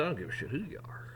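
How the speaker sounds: fast, unvoiced angry whisper; hissed threat. Standing up to the boss.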